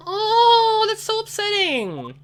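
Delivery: very high, hammed-up distressed cry; overplayed heartbreak, stretched slow; mock upset wail. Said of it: "That was upsetting".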